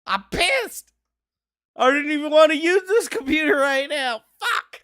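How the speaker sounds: high, laughing through mock sobs; whiny half-laugh half-cry. Real, not acted. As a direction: whine like you're about to cry, half laughing. Upset about the bug.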